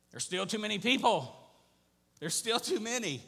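high, loud declaration; preacher voicing God, loud and insistent; emphatic objection. Lord objects to Gideon's army size.

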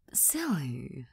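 soft unvoiced whisper. Real, not acted. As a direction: breathe the word out. Whispered punchline about silliness.

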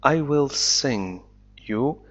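ordinary delivery

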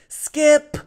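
high, loud desperate shout; crying out. Acted. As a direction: call out to the sky. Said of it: "he cried into the sky".

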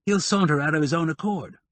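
a hint of a relaxed man, pitch up; Bushy's voice. A narrator's reading. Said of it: "Bushy said".

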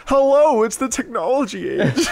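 high, laughing old man impression; mocking old man. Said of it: "a ninety-year-old man being like".

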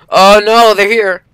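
alarmed cry as enemies appear; panicked. Real, not acted. Enemy bots arrive, panicking.

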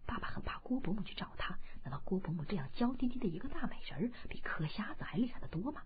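soft whisper, quick in the middle; a boy's secret thought, whispered; inner thought whisper. A narrator's reading. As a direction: murmur it as a private thought. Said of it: "心想".

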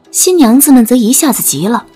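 ordinary talk

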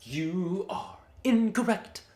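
slow, deliberate correction; slow emphatic. Acted. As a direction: drag each word out. Mock correcting the game.

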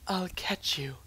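hushed, breathy call to a companion; whispery aside. Pretend. Promising Ashley he will catch her, hushed. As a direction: say it under your breath. Reassuring Ashley as she jumps down.

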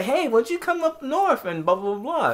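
high, airy impression of a friendly organizer; lightly voiced Canadian organizer inviting him; imitating the organizer. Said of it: "she was like".

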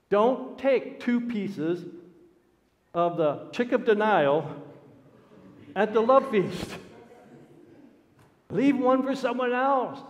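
loud, high pulpit exhortation; ringing, insistent sermon delivery; forceful preaching. Real, not acted.

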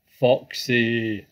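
loud, drawn-out hail to a friend; big booming greeting; excited cry. A narrator's reading. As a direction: cry the name out loudly, falling at the end. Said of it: "cried Badger".

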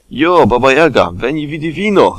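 loud high greeting call. Acted. Tigger calling out to Baba Jaga at her hut, loud high greeting. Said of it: "zakrzyknął Tygrysek".

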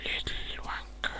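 hissed under the breath; whispered insult. Real, not acted. Gamer whispering a curse at a mob, whispered.

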